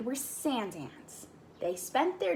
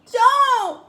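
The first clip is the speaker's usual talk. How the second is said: high, loud desperate cry of Don't; 12 semitones higher, 13 dB louder than usual. Acted. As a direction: shout to stop him. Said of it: "cried Floyd".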